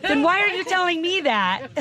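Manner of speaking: high, amused comeback; laughing retort. Retold or imitated. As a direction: fire it back through a laugh. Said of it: "And I said".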